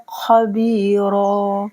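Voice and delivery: खबीरा ending in a thick, long raa; slow recitation of खबीरा; heavy ra, long aa. Shown as a demonstration. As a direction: say खबीरा with a heavy r and drawn final aa. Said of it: "रॉ अलिफ ज़बर रॉ पढ़ा जाएगा".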